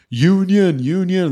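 high, loud mock yell of construction workers; retold crew yell. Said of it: "they all started yelling".

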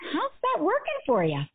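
high, pointed mother's voice; a mom challenging her crying kid; knowing, pointed parental question; mom's blunt question. Retold or imitated. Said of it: "she looked at me and she said".

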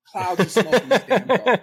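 excited. Retold or imitated. Telling hosts about the smoke-filled car, excited and loud.

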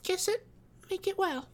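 put-on very high, small child voice, solemn; earnest, piping little-girl voice; grave little girl. A narrator's reading. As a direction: ask with a child's perfect seriousness. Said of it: "she said, with perfect gravity".

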